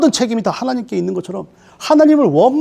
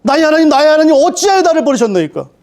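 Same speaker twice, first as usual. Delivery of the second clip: higher, louder anguished cry; Jesus crying out; 7 semitones higher, 4 dB louder than usual. Acted. Jesus on the cross crying to God, high and loud. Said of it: "십자가에서".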